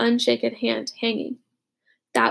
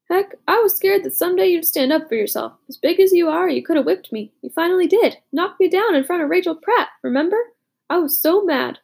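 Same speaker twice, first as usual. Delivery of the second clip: higher, louder sheepish confession; Butch voiced; 6 semitones higher, 4 dB louder than usual. A narrator's reading. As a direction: own up with rueful humor, pitched up. Butch confesses old fear.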